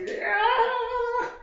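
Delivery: very high squealed yeah; triumphant yell. Real, not acted. Basking in the friends' praise, high triumphant whoop. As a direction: let out a victorious whoop.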